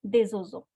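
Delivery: des oiseaux joined by a Z sound; liaison in des oiseaux. Shown as a demonstration. Teacher sums up the rule, des oiseaux linked. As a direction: carry des into oiseaux as "dez". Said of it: "you have to make liaison".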